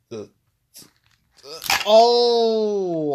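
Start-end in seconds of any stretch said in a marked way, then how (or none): 1.8-3.1 pained cry. Real, not acted. Wrestler smashed onto the steps.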